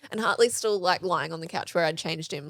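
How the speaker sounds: normal talking voice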